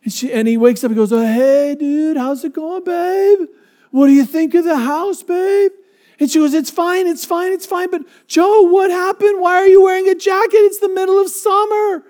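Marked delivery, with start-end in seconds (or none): 1.1-5.7 very high, slow dopey surfer voice; groggy and cheerfully clueless; dazed surfer dude. Retold or imitated. Surfer waking from heat exhaustion greeting wife, dopey high voice. 6.6-12.0 very high, fast fretful wife voice; worried wife. Retold or imitated. Wife questioning husband's jacket, very high fretful voice.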